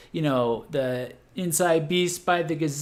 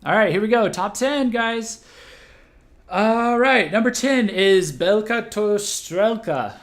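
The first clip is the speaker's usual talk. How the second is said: excited; 8 semitones higher, 6 dB louder than usual. Real, not acted. Hyped top ten countdown.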